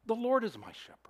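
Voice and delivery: breathy whisper of faith; hushed whispered declaration; whispered confession. Shown as a demonstration. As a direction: whisper it as a private vow.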